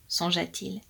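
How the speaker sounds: ordinary delivery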